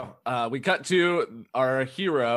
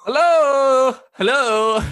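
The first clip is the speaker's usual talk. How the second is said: calling out; 13 semitones higher, 11 dB louder, slower than usual. Acted. Hero calls out in hell.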